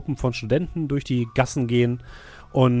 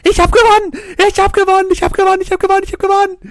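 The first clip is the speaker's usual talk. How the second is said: shrill ecstatic winner's scream, high and loud; 11 dB louder, much faster than usual. Acted. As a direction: shriek it like you just won big. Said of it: "schreit eine Frau auf". (kreischend vor Freude)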